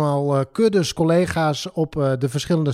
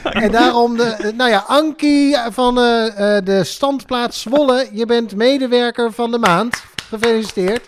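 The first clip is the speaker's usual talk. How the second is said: high, louder celebratory proclamation; raised, stretched-out congratulations to the winner; festive announcement; 8 semitones higher, 4 dB louder than usual. Real, not acted. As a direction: proclaim the award with gusto. Announcing employee of the month.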